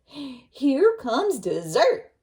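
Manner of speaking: very slow, loud gloating; wolf gloating over his next meal; greedy wolf. Acted. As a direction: drag it out hungrily. Wolf hearing footsteps.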